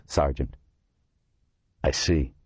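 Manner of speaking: ordinary delivery, nothing marked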